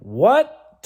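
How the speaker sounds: high, loud rising what; shocked at his own words; baffled yelp at himself; startled what. Retold or imitated. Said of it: "And then I went".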